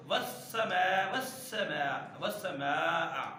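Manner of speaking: والسماء drawn out slowly with a clear seen; slow recitation. Shown as a demonstration. Teacher demonstrating the seen in والسماء. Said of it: "اب اسی کو".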